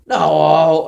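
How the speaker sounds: sharp, loud exasperated no; correcting a confused friend, loud exasperated no. Retold or imitated. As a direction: shut the misunderstanding down hard. Correcting again.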